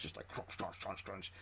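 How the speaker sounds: breathy, soft imitated crunching footsteps; hushed crunch crunch of a lurking creature; whispered crunch sounds.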